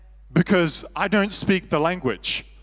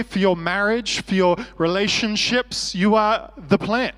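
rising preacher's cadence, stressing you; preaching refrain; 3 semitones higher, 3 dB louder than usual. Acted. Preacher to church, building the you-are-the-plan refrain. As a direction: drive the repeated line home.

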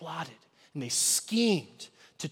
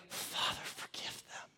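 breathy whisper of a dying man; hushed plea from the cross; whispered prayer; 13 dB quieter than usual. Acted. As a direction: whisper it, pleading. Jesus's prayer voiced on cross.

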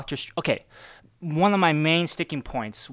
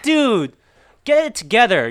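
loud, higher mock scolding; telling him off; 6 semitones higher, 9 dB louder than usual. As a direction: yell at him to get it together. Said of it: "I'm like".

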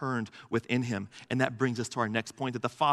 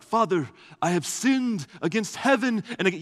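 mock contrite son; 8 semitones higher, 5 dB louder than usual. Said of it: "He's like".

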